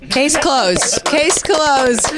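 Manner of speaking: high-pitched, playful celebrating; drawn-out, gleeful high voice; mock triumph. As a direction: crow it like a joking victory. Joking celebration of caller's problem.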